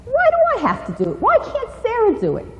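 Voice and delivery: high, fast whiny refusal; a bratty child dodging a chore; whining refusal. Shown as a demonstration. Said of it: "Disobeying or refusing to comply".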